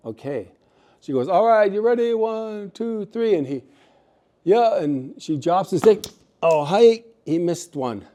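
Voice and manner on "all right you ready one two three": female eagle calling the count. Acted. She counts him in for the drop.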